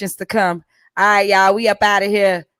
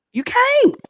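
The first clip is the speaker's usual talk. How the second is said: emphatic; 12 semitones higher, slower than usual. Acted. Emphatic warning to listeners.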